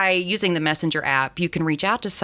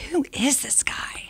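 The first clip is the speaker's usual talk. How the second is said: breathy whisper, pitched up and hushed; whispered thought; 8 semitones higher, 5 dB quieter than usual. Retold or imitated. Host recalls thinking about a new colleague, hushed whisper. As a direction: whisper it like a private aside on first meeting.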